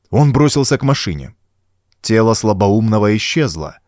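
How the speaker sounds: ordinary delivery, nothing marked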